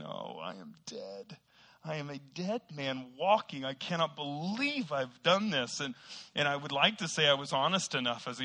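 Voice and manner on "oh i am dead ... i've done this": mock dread. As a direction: lightly act out a doomed kid's disbelief. Acts his panicked thoughts as a boy.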